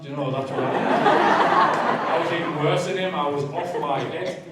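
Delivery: real laughter, loud and slow; laughing. Real, not acted. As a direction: laugh while telling the madman bit. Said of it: "But now it gets funny".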